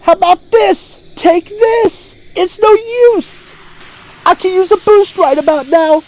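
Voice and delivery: put-on high-pitched, earnest young hero battle cries; Silver the Hedgehog impression. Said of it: "I could do Silver the Hedgehog impression".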